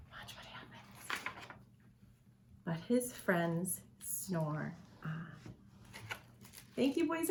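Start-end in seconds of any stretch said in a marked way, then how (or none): 0.1-1.2 whisper. Real, not acted. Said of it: "whispered cue to children". 2.6-5.3 softer, slower closing line; soft slow ending. A narrator's reading. Quiet closing refrain.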